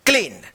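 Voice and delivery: क्लीन alone, long "ee", falling tone; model reading of clean. Shown as a demonstration. Teacher drills the class on reading it, a falling, long-voweled क्लीन. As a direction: repeat क्लीन as a clear drill answer. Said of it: "क्या पढ़ा जाएगा?".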